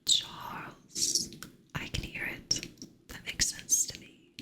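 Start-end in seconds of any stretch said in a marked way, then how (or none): 0.1-1.3 whispered transatlantic Oh, Charles with a stretched Oh; transatlantic accent. Shown as a demonstration. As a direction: breathe it like a swooning old-movie heroine.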